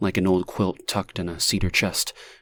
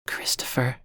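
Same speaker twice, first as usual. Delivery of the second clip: faint soft whisper; whispered name; 4 semitones higher, 6 dB quieter than usual. A narrator's reading. Stranger softly finishing his name, whispered. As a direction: breathe the name.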